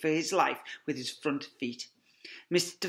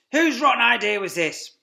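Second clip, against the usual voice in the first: higher, louder cross farmer; angry Boggis; 10 semitones higher, 13 dB louder than usual. Acted. Farmer blaming the others, loud and cross. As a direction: demand it angrily. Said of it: "said Boggis".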